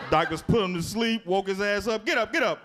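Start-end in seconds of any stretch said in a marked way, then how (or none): 2.1-2.6 high, incredulous doctor scolding a patient; mock outrage from the surgeon; doctor chewing out a patient.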